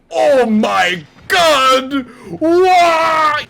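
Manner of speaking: hammed-up despairing howl to the sky; over-the-top Wario cry of anguish; Wario's anguished wail. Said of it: "He says".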